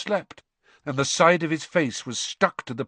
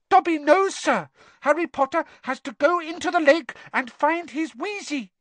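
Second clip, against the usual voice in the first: squeaky house elf. Acted. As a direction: squeak it out urgently. Dobby voiced.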